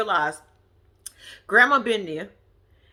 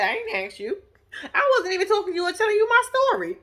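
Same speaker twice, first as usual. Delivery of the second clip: higher, fast retort breaking into a laugh; sassy comeback; 10 semitones higher, faster than usual. Retold or imitated. Vlogger imagining telling off a bossy kid, laughing and fast. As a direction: rattle it off, laughing at the cheek of it. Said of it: "That's what I would've said".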